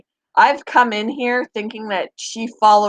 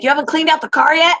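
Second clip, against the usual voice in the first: imitating husband, higher and fast; quick, higher mock complaint about the car; husband back from the gym, imitated higher and fast; 7 semitones higher, 3 dB louder, faster than usual. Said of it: "He's gonna be like".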